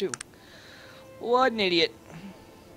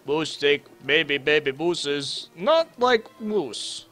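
put-on caveman Russian accent. Gamer voicing his character Moose in a mock Russian accent. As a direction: talk like a simple Russian brute in a Russian accent. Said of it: "Moose think maybe sometime he have Russian accent".

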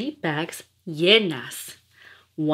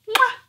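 kiss sound; 4 dB louder than usual. Acted. She gives dog a kiss.

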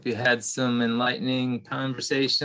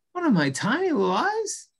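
much higher, squeaking in alarm; squeaky disbelief; 13 semitones higher than usual. Acted. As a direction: let the voice jump up in shock. Mouse shocked, squeaks higher.

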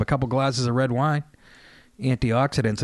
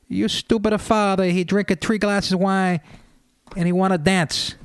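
grandmother's complaint; 7 semitones higher than usual. Retold or imitated. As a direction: squeal out the complaint like an upset old nonna. Grandmother complains about drunk grandfather.

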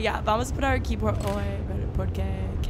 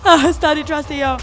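scared cry; 5 semitones higher, 11 dB louder than usual. Real, not acted. Chased by monster in horror game.

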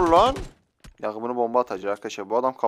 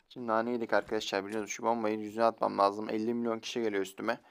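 normal talking voice

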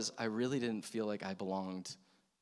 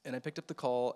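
ordinary talk

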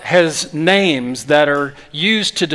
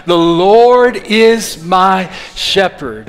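slow, louder, every word given weight; slow group recitation; 3 semitones higher, 4 dB louder, much slower than usual. Shown as a demonstration. Pastor leading the congregation in the psalm, slow and emphatic. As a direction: lead the room through the verse word by word. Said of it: "One more time".

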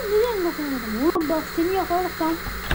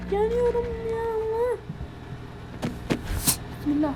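ordinary talk

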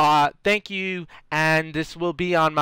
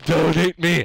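usual speaking voice

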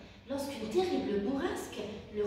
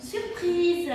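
higher, louder joyful shout; shouting surprise; 7 semitones higher, 8 dB louder than usual. A narrator's reading. The animals revealing the new nest to the hedgehog, shouting joyfully. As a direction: burst out together with glee. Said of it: "crièrent-ils en chœur".

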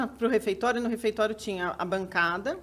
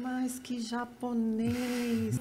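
very slow, softer astonished drawl; drawn-out surprise; 9 dB quieter, much slower than usual. Retold or imitated. Seeing the man on stage, slow astonished disbelief. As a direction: stretch it out in disbelief.

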